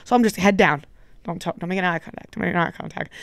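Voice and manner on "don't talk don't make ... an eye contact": low, soft, hurried-under-the-breath reminder; quiet self-coaching. Retold or imitated. Guest avoiding the celebrity, quietly coaching self. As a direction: keep it small and unnoticed.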